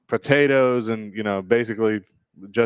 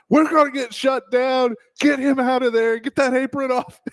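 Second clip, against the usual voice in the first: high, urgent grandma orders; panicked grandmother; 3 dB louder than usual. Retold or imitated. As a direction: bark the orders like a frantic grandmother. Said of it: "but she goes".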